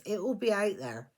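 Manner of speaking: ordinary talk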